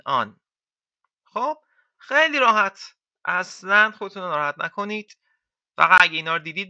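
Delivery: ordinary talk, nothing marked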